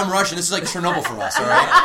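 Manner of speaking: hammed-up Russian accent, mocking a friend; lightly put-on Russian accent, laughing through it; bad Russian accent. Teasing a friend about laser on his balls, in a bad Russian accent. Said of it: "Sorry for my horrible Russian accent".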